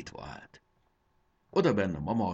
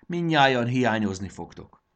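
raised, louder goodbye call; called-out farewell; 6 semitones higher, 8 dB louder than usual. A narrator's reading. Jakab tells the crowd goodbye from the air, calling out. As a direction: call it out to everyone below.

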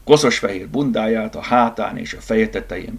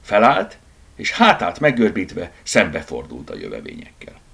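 ordinary delivery

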